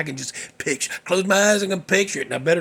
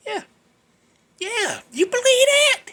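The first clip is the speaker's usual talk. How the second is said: very high squeal of disbelief; incredulous. Real, not acted. Storyteller asking listeners to believe the trick, incredulous. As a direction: pitch it way up, appalled.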